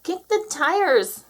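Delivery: high, stretched-out command; urgent shout. A narrator's reading. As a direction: call it out slowly and high. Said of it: "shouted Moo".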